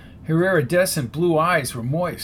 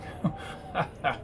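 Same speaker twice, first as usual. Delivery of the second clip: short breathy laugh; soft airy chuckle; narrator laughing; 9 dB quieter than usual. A narrator's reading. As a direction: let a quiet laugh slip out. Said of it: "I began laughing".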